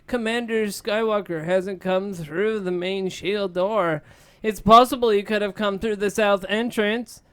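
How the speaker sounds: deck officer voice. Acted. Deck officer reports to Han, high careful report. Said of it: "the deck officer replies".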